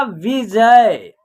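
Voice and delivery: विजय very slowly, both syllables stretched; pronunciation demo. Shown as a demonstration. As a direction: sound out विजय for learners, drawn out. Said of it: "क्या हो जाएगा?". (बहुत धीमे, खींचकर विजय का उच्चारण)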